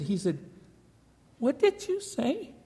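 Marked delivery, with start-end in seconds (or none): 1.4-2.5 high, slow, stunned "what did you say"; a man who can't believe his ears; startled disbelief. Retold or imitated. Store owner to Mrs Beale, in high startled disbelief.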